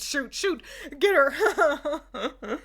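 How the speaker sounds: half-laughed mock battle cry; urgent game call. Player fighting a magical girl, laughing shout to shoot.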